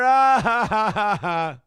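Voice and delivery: loud jeering laugh at someone; mocking laughter. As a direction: shout then cackle mockingly. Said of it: "We ended up going".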